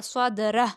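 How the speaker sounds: सदरह cut off on a final breathed h, no long "hoo"; waqf stop ending. Shown as a demonstration. Teacher showing pupils the pause form, सदरह stopped on h. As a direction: end सदरह on a still "h". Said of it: "वक्फ़ की सूरत में इसको इस तरह पढ़ेंगे".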